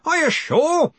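incredulous demand, voice leaping up. A narrator's reading. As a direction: press hard for confirmation. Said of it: "the Arch-Chancellor demanded".

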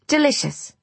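said loudly. A narrator's reading. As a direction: say it out loud, overbright. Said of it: "she said loudly".

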